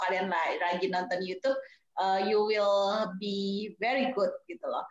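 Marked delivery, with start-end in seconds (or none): none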